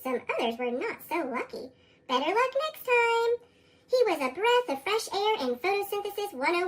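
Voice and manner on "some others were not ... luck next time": usual speaking voice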